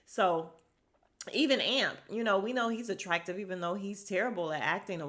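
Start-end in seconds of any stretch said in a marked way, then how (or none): none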